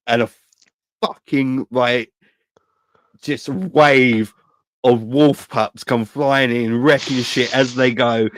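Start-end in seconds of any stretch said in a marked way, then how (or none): none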